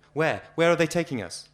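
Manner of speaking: a frightened prisoner begging to know; frantic question. Acted. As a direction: press the question in rising panic. Frantic prisoner asking repeatedly.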